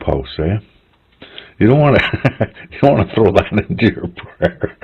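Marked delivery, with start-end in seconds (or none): 1.6-4.8 real laughter breaking into the words; cracking up mid-sentence, pitch lifted; laughing. Real, not acted. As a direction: talk through helpless giggles. Cracks up at the mistaken word.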